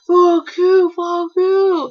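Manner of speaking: high, loud imitation of an irate mom; mock angry cursing. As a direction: hurl it like a driver in road rage. Angry mother cursing from her car.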